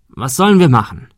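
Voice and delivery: ordinary delivery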